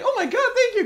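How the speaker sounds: very high, loud squeal of joy with laughter; squealing delight. Retold or imitated. Bart thrilled at his birthday cash, very high laughing squeal. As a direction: gush like a kid handed a box of money.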